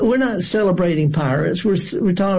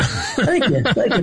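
laughing; 5 semitones higher, slower than usual. Real, not acted. Thanks after groan at pun.

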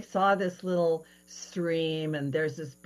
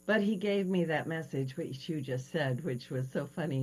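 usual speaking voice

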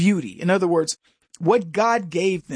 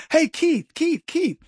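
high, sing-song calling of Keith; high playful calling of a wrong name; mock mispronunciation; 9 semitones higher, slower than usual. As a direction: cheerfully call out Keith. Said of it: "Hi, I'm Kevin".

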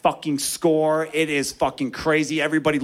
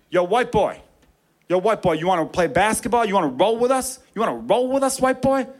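put-on teen gang leader voice; 5 semitones higher than usual. Teen gang leader hails nine-year-old.

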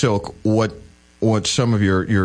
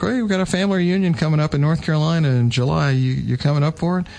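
lightly voiced aunt, pitched higher; chirpy higher aunt asking about the reunion; elderly aunt voice; 7 semitones higher than usual. Aunt inviting him to the reunion, higher aunt voice.

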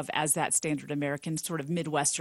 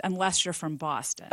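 ordinary talk

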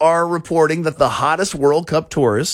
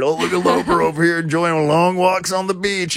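mocking quote; 6 semitones higher than usual. Imagined listeners teasing him online.

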